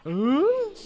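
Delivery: high, loud rising "ooh"; startled ooh. Real, not acted. Player hearing an enemy above, startled ooh. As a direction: yelp a sharp-pitched ooh. Startled noise while evading enemies.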